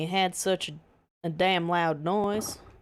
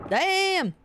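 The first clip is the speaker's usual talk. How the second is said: high yelped Damn!; a sudden pained Damn! jumping up high; 12 semitones higher than usual. Real, not acted. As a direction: yelp it higher as the pain hits. Bitten, second sharper cry.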